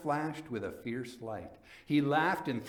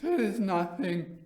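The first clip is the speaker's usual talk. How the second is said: dismissive Fortunato; 8 semitones higher, 5 dB louder than usual. A narrator's reading. Fortunato brushes off cough.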